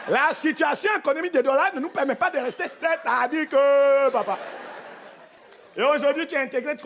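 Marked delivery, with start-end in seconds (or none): 0.1-4.4 Cameroonian accent imitation. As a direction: exaggerate the Cameroonian newcomer, stretching the linking phrase. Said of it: "tu parlais comment?". (accent camerounais de Douala exagéré)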